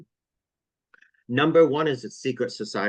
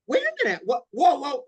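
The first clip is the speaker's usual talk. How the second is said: pretend shock; 14 semitones higher, 5 dB louder than usual. Imagined shock at betrayal.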